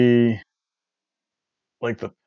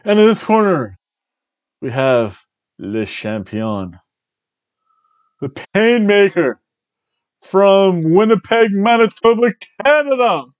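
high, loud booming ring announcer; 8 semitones higher, 4 dB louder, slower than usual. Pretend. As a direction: stretch out the name like a ring intro. Ring announcer introduces champion.

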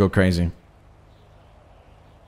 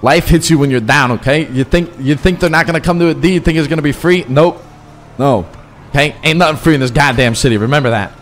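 raised, higher-pitched lecture to the crew; 7 semitones higher, 5 dB louder than usual. Acted. Boss lecturing his crew on the city, in a raised voice. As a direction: lay down the law loudly, like a gang boss.

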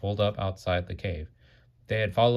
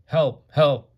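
high, loud cries for help; crying for help; 7 semitones higher, 9 dB louder than usual. A narrator's reading. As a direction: call out desperately for help. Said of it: "Rudolph heard voices crying".

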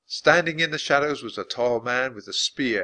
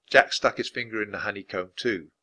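ordinary delivery

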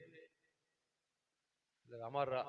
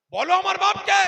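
much higher and louder shouted demand; furious demand; 17 dB louder than usual. Acted. Son yelling at his mother, furious demand. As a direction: bark the question at her.

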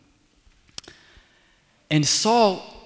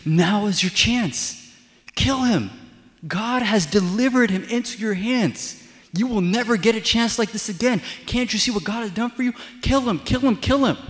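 high, loud, insistent urging; urgent urging; 8 semitones higher, 4 dB louder than usual. Retold or imitated. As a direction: egg him on, voice raised and pressing. David's men urging him to kill.

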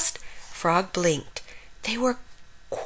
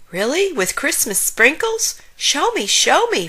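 high, eager begging; excited cry; 7 semitones higher than usual. A narrator's reading. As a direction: beg like a thrilled little character. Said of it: "Frog cried".

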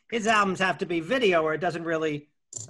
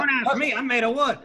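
high, loud dummy voice; ventriloquist dummy; 7 semitones higher, 4 dB louder than usual. Pretend. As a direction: play the wooden puppet. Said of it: "Hey, I'm the dummy".

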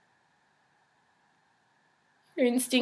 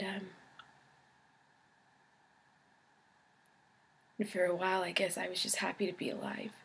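very soft, near-whispered recollection; hushed confession; 12 dB quieter than usual. Acted. Patient recalls waking up alive, hushed. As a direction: let it out barely above a whisper.

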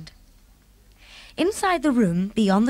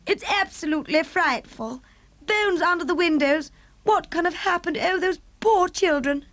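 higher, distressed regal voice; the Queen horrified; 9 semitones higher than usual. Acted. Queen lamenting the lost children, higher and distressed. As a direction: pity for the poor children.

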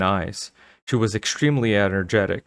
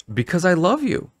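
higher-pitched curt reply; 10 semitones higher than usual. A narrator's reading. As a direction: answer curtly, a touch hurt. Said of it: "Missus Caldwell replied curtly".

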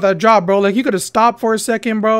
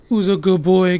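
usual speaking voice

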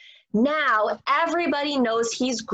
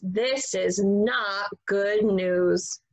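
plain reading voice

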